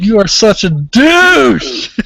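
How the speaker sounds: slow, loud, drawn-out teasing; hammed-up jab; mock insult. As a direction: rib your friend for ignoring chat.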